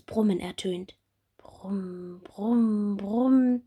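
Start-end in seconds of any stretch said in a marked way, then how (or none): 1.4-3.6 growing bumblebee buzz brumm brumm brumm. Acted. Bumblebee taking off, buzz growing louder. Loud buzzing sounds out.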